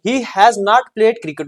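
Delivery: very slow, word by word dictation. Shown as a demonstration. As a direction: dictate it slowly for notes. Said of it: "teacher dictates example slowly".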